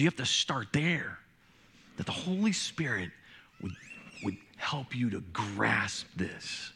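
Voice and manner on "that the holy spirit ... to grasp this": dropping to a near whisper, slowing down; hushed. Acted. Pastor to congregation, on needing the Spirit, hushed near whisper. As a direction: lean in and breathe it to the congregation.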